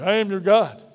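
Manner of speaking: ordinary talk, nothing marked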